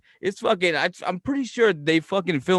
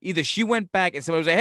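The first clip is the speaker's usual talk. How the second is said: ordinary talk, nothing marked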